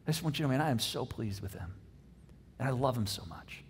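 low, soft, heartfelt affirmation; tender fatherly love. Acted. God affirming his son before any deeds, softly loving. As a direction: drop low and quiet, full of love.

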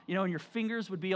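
ordinary talk

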